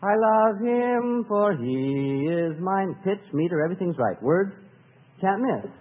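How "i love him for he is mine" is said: slow, stretched-out recitation; measured, elongated delivery of a lyric; drawn-out dutiful line. Shown as a demonstration. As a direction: stretch every word slowly, as if singing it. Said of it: "And we can sing it".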